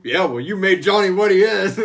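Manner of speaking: loud, high snippy retort; mock comeback. Eddie's soul snapping back at Doc, loud mock retort. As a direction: fire it back with hammed-up spite. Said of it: "they would fight a lot".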